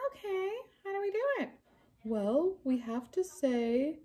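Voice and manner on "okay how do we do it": higher, rapid puppet voice; a keen little puppet hurrying; eager puppet. Acted.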